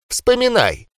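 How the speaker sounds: sharp high command. Acted. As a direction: order him to remember. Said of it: "прозвучало командой".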